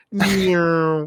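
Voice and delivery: high imitated engine whine; car zoom noise. Driving off after honking, high zoom noise. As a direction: voice a car zipping away.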